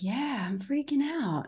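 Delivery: a mild soft-spoken admission; admitting it. Retold or imitated. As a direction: confess the panic quietly. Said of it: "And I was like".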